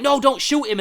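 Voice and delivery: high and loud, frantic; pleading shout. Retold or imitated. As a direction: cry out to stop the shot. Rewriting the doctor's line.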